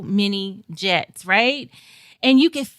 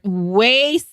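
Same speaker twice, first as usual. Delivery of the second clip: normal talking voice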